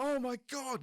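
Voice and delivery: high, loud gasp of horror; aghast at the mess; shocked cry; horrified shock. Retold or imitated. Blood squirts across table.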